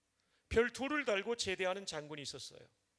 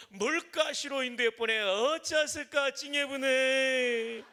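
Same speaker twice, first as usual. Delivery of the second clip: high, loud, slow Jeolla dialect reading; 4 semitones higher, 7 dB louder, slower than usual. Shown as a demonstration. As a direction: lift the pitch and stretch the words in a broad Jeolla dialect, ending in a loud cry. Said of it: "전라도 버전입니다". (전라도 사투리로 높고 크게, 느리게 읽기)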